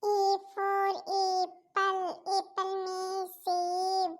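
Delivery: put-on child sounding out a lesson very slowly; sing-song schoolbook reading, very slow; slow recitation. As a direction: drag each word like rote learning.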